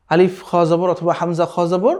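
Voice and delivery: usual speaking voice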